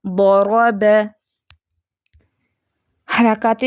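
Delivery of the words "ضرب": ضرب with heavy ض and ر, thin ب; heavy and light letters. Shown as a demonstration. Teacher repeating the example for students, careful recitation. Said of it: "ضاد और रा दोनों फैटे और बा बारीक".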